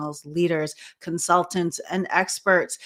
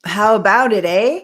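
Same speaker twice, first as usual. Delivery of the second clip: loud, playful comeback to the host; loud teasing; 3 semitones higher, 9 dB louder than usual. Real, not acted. Guest teasing the host who mocked her accent, loud and playful. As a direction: call it out loud, laughing at the joke.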